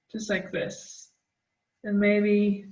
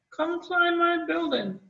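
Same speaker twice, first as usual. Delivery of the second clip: high playful call to passers-by; mock hollering; 8 semitones higher than usual. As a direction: call out high like a cheerful building. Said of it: "hollering at people".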